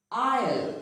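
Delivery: "Isle" with no S sound, like "I'll"; silent S. Shown as a demonstration. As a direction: say "Isle" as eye-ul, S left silent. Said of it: "it is pronounced as isle".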